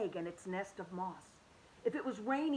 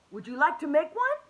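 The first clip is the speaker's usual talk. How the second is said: eager inventor; 6 semitones higher, 9 dB louder than usual. Acted. Roberto offers to Hoppy.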